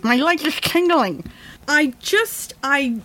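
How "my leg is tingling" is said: a light hint of a TV pundit's voice; quick mock of a famous newsman's line; Chris Matthews impression. Borrowing a pundit's words to mock her own rage, lightly voiced. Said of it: "A little like Chris Matthews".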